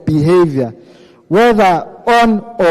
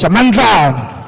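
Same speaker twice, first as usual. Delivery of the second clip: slogan call; 3 semitones higher, 3 dB louder, slower than usual. Real, not acted. Rally slogan call at close.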